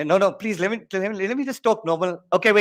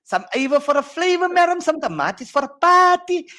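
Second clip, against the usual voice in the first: higher, hammed-up Cape Flats accent; a Cape Flats accent put on to tease; 12 semitones higher, 4 dB louder than usual. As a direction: lay on a thick Cape Flats accent for the class. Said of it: "talk like the guys from Durbanville".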